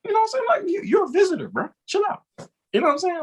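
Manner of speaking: high, lightly mocking local telling a visitor off; mock local scolding. Imitating an Atlanta local scolding a New Yorker, high mocking. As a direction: tease the visitor to chill out. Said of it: "and be like".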